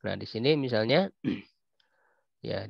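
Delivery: normal talking voice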